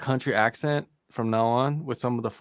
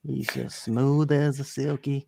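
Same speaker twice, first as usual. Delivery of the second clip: drawly accent. Real, not acted. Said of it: "Sound like a King of the Hill character".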